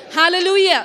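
loud joyful praise shout; shouted hallelujah. Real, not acted. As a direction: lift it up and shout it. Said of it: "Lift your hands and say hallelujah".

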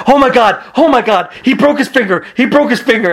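high-pitched imitated panic; Daniel Bryan freaking out. Teammate reporting the broken finger backstage, imitated freak-out. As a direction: repeat it frantically, mimicking alarm. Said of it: "Daniel Bryan was freaking out".

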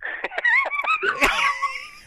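laughing hard over the phone; big helpless laugh. Real, not acted. Caller laughs at joke.

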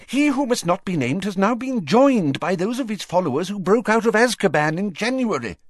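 high-pitched agitated rush; urgent, gathering haste; increasingly rapid. A narrator's reading. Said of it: "speaking more and more rapidly".

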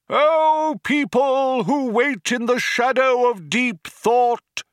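high, loud, slow orator proclaiming; rally the crowd over a tannoy; crying out to crowd. A narrator's reading. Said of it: "he cried out".

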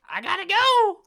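very high, loud squeaky yell; speedster princess impression. Princess too fast to film rushing off, high shouted impression. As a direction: shout it in a sky-high cartoon voice. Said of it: "she sounds like that".

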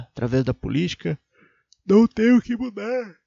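usual speaking voice

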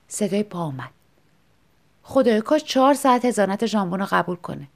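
ordinary delivery, nothing marked

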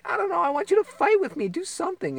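high-pitched imitated woman; pleading wife. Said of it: "And then she's like".

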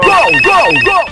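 long shouted goal call; goal scream. Real, not acted. (grito de gol)

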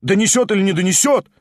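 agitated crowd; rapid shouted questions. A narrator's reading. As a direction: pelt him with questions. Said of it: "сыпались вопросы".

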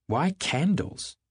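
high, slow objection; objecting. Acted. Daisy at the dinner table, high slow objection. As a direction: frown at the candles as you ask. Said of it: "objected Daisy, frowning".

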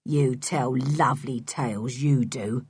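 a hint of a mocking rough man; Mister Stick's voice.

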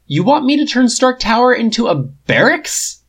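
high loud indignant question; outraged protest about his tower. A narrator's reading. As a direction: blow up at the idea. Tony outraged at plan.

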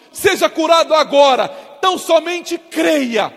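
loud proclamation of healing; preaching at full force. Acted. Preacher declaring healing to sick viewers at home, loud and forceful. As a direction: declare it with authority over them.

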